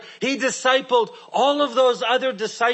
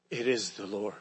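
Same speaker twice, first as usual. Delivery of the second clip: almost whispered recognition, much softer and lower; hushed awe; 13 semitones lower, 12 dB quieter than usual. Acted. Disciple telling Peter it is Jesus, hushed and awed. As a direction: breathe it out in quiet wonder.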